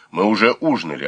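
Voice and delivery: usual speaking voice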